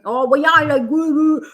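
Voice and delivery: exaggerated Geordie accent as a send-up; mock thick Geordie. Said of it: "starts thickening up their accent".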